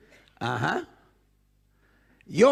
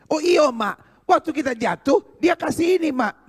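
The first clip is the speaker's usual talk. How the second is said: put-on high, louder, eager boy telling mum; excited mock-child voice, pitched up; child's sudden recollection, acted; 7 semitones higher, 4 dB louder than usual. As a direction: burst out as the son remembering. Boy suddenly remembers the money.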